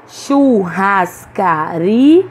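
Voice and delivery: "Churrascaria" very slow, syllable by syllable, ri rising strongly; stress on penultimate syllable. Shown as a demonstration. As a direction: stretch "Churrascaria", peaking on ri before a detached a. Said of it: "pronunciamos com mais força a penúltima". ("Churrascaria" bem devagar, silabado, com força em ri)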